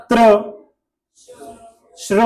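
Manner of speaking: ordinary talk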